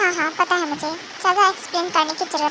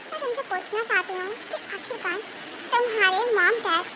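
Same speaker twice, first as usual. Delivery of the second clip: ordinary talk, nothing marked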